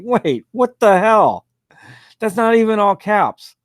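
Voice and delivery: baffled at the sloppy trademark; mock outrage at the missing caps; incredulous. Real, not acted. Host mocks trademark on notice.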